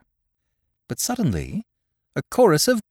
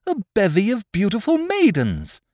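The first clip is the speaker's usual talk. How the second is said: higher, delighted outburst; marveling exclamation; 6 semitones higher than usual. Acted. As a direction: gasp it out in wonder. Frederick marvels at the maidens.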